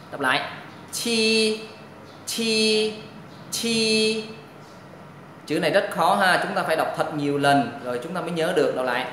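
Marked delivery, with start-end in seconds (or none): 0.9-4.2 "chi" with a puff of air, held high and level; aspirated sound. Shown as a demonstration. Teacher introduces the hard-to-read letter, aspirated sound. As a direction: push air through the teeth on "chi". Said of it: "Phải có hơi bật ra".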